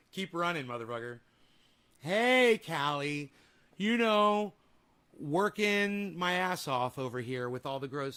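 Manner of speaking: usual speaking voice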